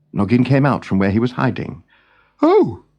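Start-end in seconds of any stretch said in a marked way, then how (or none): 2.4-2.8 pitched up, kindly king's voice; a young king fretting over his birds; Noggin's raised voice. Acted. Noggin, the king, to his bird friend: raised, kindly voice.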